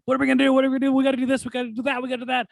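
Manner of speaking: high, frantic, mock panic; panicked. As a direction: squeak it out like a frantic assistant. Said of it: "he's freaking out and he's like".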